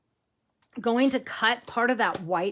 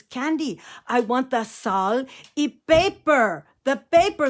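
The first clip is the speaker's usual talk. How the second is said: imitated irate grandfather, raised loud Mexican-accented voice; Grandpa Joe; 6 semitones higher, 6 dB louder than usual. Grandpa demands salt and pepper, exasperated Mexican accent. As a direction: hammer it out, mock-furious, Mexican accent. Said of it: "like, totally losing his temper".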